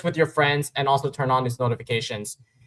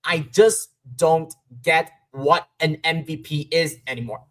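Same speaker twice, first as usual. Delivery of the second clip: usual speaking voice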